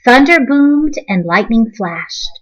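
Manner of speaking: usual speaking voice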